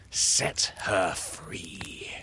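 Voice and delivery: slow, hushed, menacing command; the captain's order from the dark; imitating Hook. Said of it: "imitated the voice of Hook".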